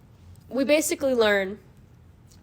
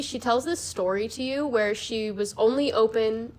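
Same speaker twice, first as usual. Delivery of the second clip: usual speaking voice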